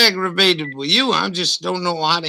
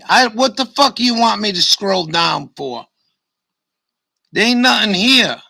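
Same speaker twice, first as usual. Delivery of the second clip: frustrated outburst; 6 semitones higher, 5 dB louder than usual. Real, not acted. Frustrated at the website on screen.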